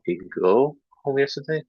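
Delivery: gently puzzled repeat; questioning echo. Shown as a demonstration. Teacher gently questions a student's error. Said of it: "a little".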